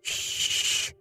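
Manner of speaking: urgent whispered shush; whispered shush to a prisoner; hushing whisper. Acted. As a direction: hush her at once, breathless. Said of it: "Keep thy voice in thy head".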